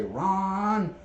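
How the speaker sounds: put-on high old grandmother calling his name. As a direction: call out like grandma from the other room. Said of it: "my name in my grandmother's voice".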